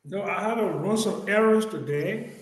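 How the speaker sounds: ordinary talk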